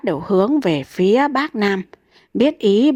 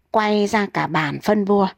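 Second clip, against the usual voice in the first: usual speaking voice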